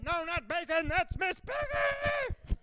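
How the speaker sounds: very high, slow screechy Muppet voice; hammed-up Muppet voice. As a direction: squeal it like a Muppet. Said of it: "Muppet voices".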